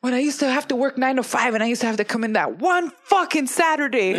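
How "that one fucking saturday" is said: comically aggrieved, pitched-up griping; mock exasperated complaint; exasperated venting. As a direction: relive the Saturday-work dread, half laughing. Acts out dreading Saturday work.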